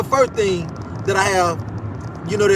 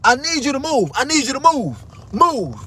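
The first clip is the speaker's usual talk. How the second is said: higher, insistent repeat; mimicking bossy demand; 6 semitones higher than usual. Retelling the guard ordering him, insistent mimicry. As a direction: copy her pushy order. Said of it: "This, this is her exact words".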